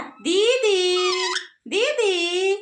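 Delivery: high, drawn-out model word; coaxing a parrot. Shown as a demonstration. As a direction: stretch the word slowly for a bird to copy. (तोते को सिखाती खिंची, ऊँची आवाज़)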